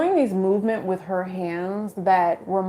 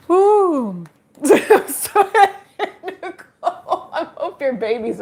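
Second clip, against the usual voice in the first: laughing; 8 semitones higher, 5 dB louder, slower than usual. Real, not acted. Apologizing through laughter.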